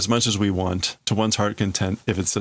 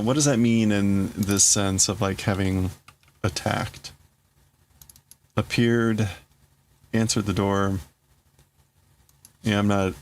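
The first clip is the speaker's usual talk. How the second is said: usual speaking voice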